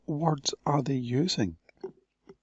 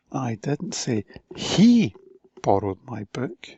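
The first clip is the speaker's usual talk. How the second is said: "he" hit loud and slow; slow, "he" stressed; 2 semitones lower, 5 dB louder than usual. Shown as a demonstration. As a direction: lean on "he". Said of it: "different emphasis on different words".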